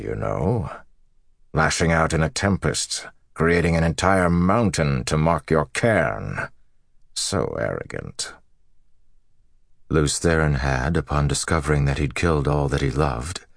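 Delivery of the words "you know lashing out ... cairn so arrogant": scornful villain Moradin. Acted. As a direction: taunt an old enemy with lofty contempt.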